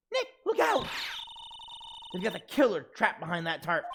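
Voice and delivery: high, loud warning cry; alarmed sidekick calling out danger; acted alarm. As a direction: shout a sudden warning, then explain urgently.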